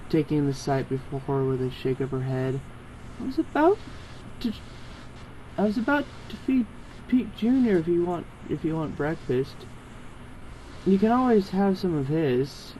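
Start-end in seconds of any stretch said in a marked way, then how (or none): 3.2-12.7 lightly raised, friendly offer. Acted. Proprietor offering the hungry agent breakfast, a touch higher. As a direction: hold it out kindly, voice lifted. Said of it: "she offered".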